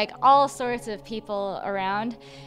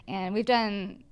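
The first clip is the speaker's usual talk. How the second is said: ordinary talk, nothing marked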